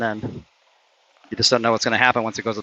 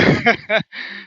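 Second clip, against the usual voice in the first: a short laugh, higher and louder; relieved high laugh; 10 semitones higher, 4 dB louder than usual. Real, not acted. Relieved after a close putt.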